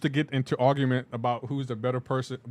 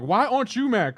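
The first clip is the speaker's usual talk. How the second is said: higher, louder mock confrontation; upset girlfriend; 8 semitones higher, 6 dB louder than usual. Streamer mimicking girlfriend in pineapple argument, pitched-up indignation. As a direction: imitate an indignant partner.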